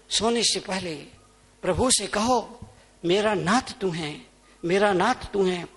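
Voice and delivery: soft, intimate, reverent coaxing; gentle prayer. Real, not acted. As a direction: coax them quietly into prayer. Same prayer, taken gently. (धीमे, कोमल स्वर में प्रार्थना)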